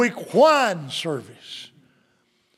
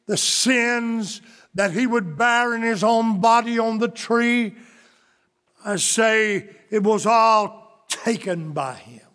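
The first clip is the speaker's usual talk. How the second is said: full-force preaching; 2 semitones higher than usual. Acted.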